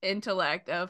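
ordinary talk, nothing marked

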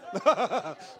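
loud, high burst of laughter; real laugh. Real, not acted. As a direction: crack up at your own joke. Laughs at own joke.

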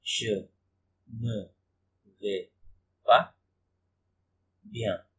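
Je, ne, vais, pas, bien spoken one at a time with pauses; slow, quiet, word-by-word dictation. Shown as a demonstration. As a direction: separate every word for learners. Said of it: "teacher repeats word by word".

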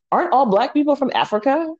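high, questioning imitation; mock naive question. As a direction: imitate a clueless classmate asking in earnest. Quoting an ignorant classmate.

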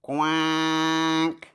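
lone drawn-out duck quack. Acted. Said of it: "Now bring out Duck".

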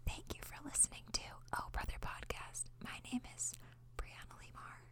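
soft ASMR whisper; whispered sign-off. Pretend. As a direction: whisper the outro.

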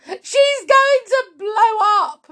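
high, loud, stretched-out panic; wailing. Acted. Wife fears Grandma will explode, panicked wail. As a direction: wail as disaster looms. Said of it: "Missus Cranky wailed".

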